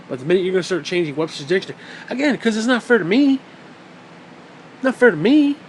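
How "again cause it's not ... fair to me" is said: higher, louder mock complaint; mock grievance. Pretending to be wronged over marriage rules, mock grievance. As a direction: play up the injured protest. Plays aggrieved would-be dog spouse.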